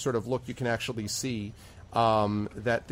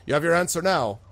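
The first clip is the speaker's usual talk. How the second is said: higher, louder bartender voice; raised-voice barkeep; 6 semitones higher, 5 dB louder than usual. Acted. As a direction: greet them across the bar as the bartender. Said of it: "bartender ... nods his head and is like".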